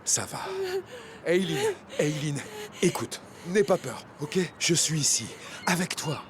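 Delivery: gentle, reassuring, often hushed; soothing. Acted. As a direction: friend hushing a panicking girl, tender and steady. Soothing a panicking friend.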